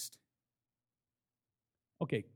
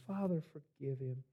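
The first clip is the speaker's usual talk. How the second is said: much softer, lower reverent murmur; hushed prayer; 5 semitones lower, 10 dB quieter than usual. Retold or imitated. Preacher cherishing Jesus's words of forgiveness, hushed. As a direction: breathe it out in reverence.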